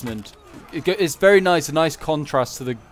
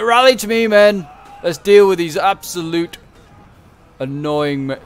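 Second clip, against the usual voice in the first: high, loud general rallying his men; rallying battle cry; 5 semitones higher, 8 dB louder than usual. Retold or imitated. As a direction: shout to gather the troops, slowing at the end. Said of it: "It's like".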